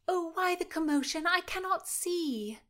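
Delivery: put-on high, eager girlish voice; eager young lady. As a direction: peer over the crowd, curious. Mary straining to see.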